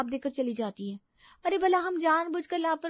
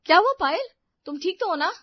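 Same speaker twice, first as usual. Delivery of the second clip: loud, urgent questioning; alarmed concern; 3 semitones higher, 10 dB louder than usual. Acted. Sister-in-law hearing Payal cry out in the kitchen, alarmed. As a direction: call out in worried alarm.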